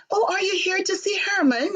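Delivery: imitating a jolly high lady; bright sing-song question; nice and jolly. Stepmother asks the visitor his purpose, jolly and high. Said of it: "so nice and jolly".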